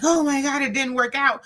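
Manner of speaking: devastated, lightly acted; mock devastation. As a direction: lament it like a let-down little girl. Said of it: "we're so devastated".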